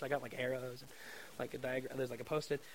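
ordinary delivery

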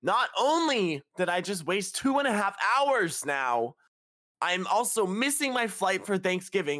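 high, loud, slow indignant rant; retold outburst. Traveller missing Thanksgiving flight yelling at agent, high angry. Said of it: "I was like".

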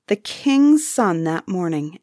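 ordinary delivery

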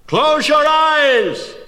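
high, loud and very slow warning; urgent shout. A narrator's reading. Perseus warning his mother before raising the head, slow loud shout. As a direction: stretch every word out as a command. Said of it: "he shouted".

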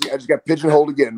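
ordinary talk, nothing marked

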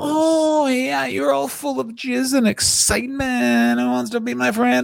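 high, drawn-out gushing voice; slow, high-pitched mock excitement; mock excited teasing. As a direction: play an over-excited tease in a high voice.

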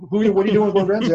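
a hint of a suspicious producer; startled question. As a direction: rattle it off, caught off guard. Martha Stewart producer suspicious.

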